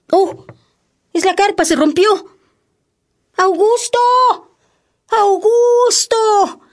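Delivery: high panicked calling out; alarmed shouting. Acted. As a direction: cry out as the tent tears. Alarm, the tent broke.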